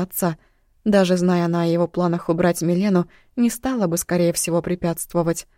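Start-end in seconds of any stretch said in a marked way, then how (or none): none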